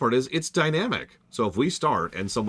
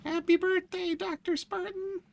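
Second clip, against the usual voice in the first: silly falsetto greeting; slower than usual. Pretend. Birthday greeting to friend.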